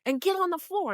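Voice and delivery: high, pressing mock command; man insisting she join him on the floor; insistent demand. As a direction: push hard, no arguing. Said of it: "he's very insistent".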